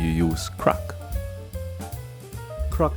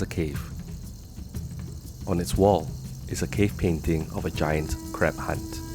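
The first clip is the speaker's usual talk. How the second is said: screenplay narration; 5 dB quieter than usual. A narrator's reading. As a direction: read it like a movie opening. Screenplay scene setting.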